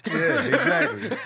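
laughed out high and slow, words riding the laugh; laughing agreement. Real, not acted. Friend laughing at the joke about Zara's name, high and slow. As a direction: crack up while agreeing.

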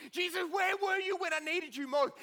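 loud, high desperate question; anguished cry. Acted. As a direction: shout it up at Jesus in grief. Martha crying out to Jesus.

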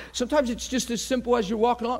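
usual speaking voice

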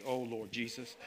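ordinary talk, nothing marked

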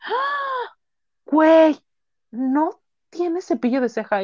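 mock-shocked high squeal of disbelief; shocked sister. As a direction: imitando a Diana, chillido agudo e incrédulo. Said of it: "Me hizo una jeta así como de". (hermana incrédula, voz aguda exagerada)

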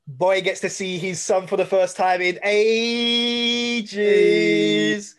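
loud, high, building excitement; celebrate, stretching the last word out long; real joy, rising to a loud drawn-out finish; elated. Real, not acted. Delighted at finally seeing his son.

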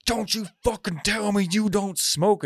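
high, slow, indignant rant; hammed-up street-guy outrage, pitched high; angry homeless guy. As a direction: imitate an outraged homeless man, drawn out. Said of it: "And he's like".

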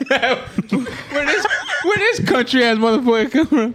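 high-pitched, through laughter; laughing. Real, not acted. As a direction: crack up while trying to get it out. Cracking up at the accent attempt.

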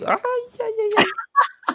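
high drawn-out ai ai ai; pained cries. Real, not acted. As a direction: cry out in pain. Finger poked in the eye.

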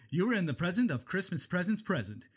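ordinary talk

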